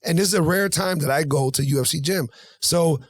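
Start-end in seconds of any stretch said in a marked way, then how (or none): none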